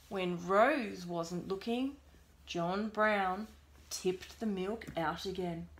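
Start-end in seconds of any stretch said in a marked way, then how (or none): none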